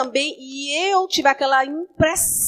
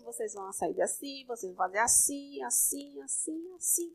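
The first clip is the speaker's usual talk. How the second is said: quiet prophetic retelling; 13 dB quieter than usual. Retold or imitated. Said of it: "vai acontecer desse jeito".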